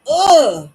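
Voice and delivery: high, loud ugh of dread; groan of dread. Real, not acted. Dreading having to schedule a call, a high loud groan. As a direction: let out a fed-up groan.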